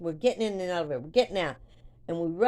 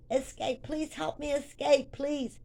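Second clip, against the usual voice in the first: high-pitched playful plea for rescue; play-acted cry for help; mock pleading; 7 semitones higher than usual. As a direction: beg in a high, put-on voice. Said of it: "this is how I was acting".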